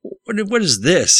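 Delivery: alarmed yell. A narrator's reading. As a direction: yell back in disbelief at the screen. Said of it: "he yelled back".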